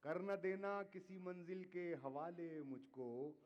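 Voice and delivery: measured, declaiming poetry to audience; ghazal recitation. Acted. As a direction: recite each couplet with weight. Poet recites line.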